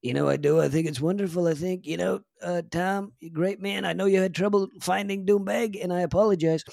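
high, loud Trump voice, boastful and rambling; Trump impression. Comedian doing his Trump for the interviewer, loud and boastful. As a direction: do Donald Trump bragging to a golf impressionist. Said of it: "I have a bit of a Trump".

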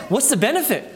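a lightly voiced scoffing sinner; mock wicked scoff. Preacher voicing the wicked for the brethren, a mild scoff. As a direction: shrug it off as pointless. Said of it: "that's how the wicked sound".

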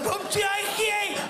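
very high, slow, choked-up squeal; hammed-up fight against tears; mock near-crying. Comedian replaying his answer to a grateful fan, mock tearful squeal. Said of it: "I was gonna cry".

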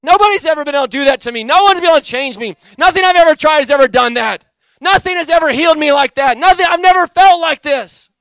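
near-shouted amazed worship; ecstatic worship. Retold or imitated. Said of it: "I began to worship the Lord".

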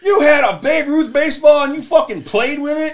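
put-on high, loud, crying-out kid panic; panicked shout. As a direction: wail it like the world just ended. Friends panic in retold scene.

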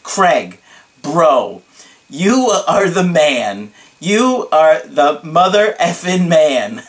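slow, emphatic hype with weight on each word; dramatic client imitation. Grateful client praising his coach, dramatic and drawn out. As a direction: imitate an over-the-top admiring bro. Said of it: "So he goes like this".

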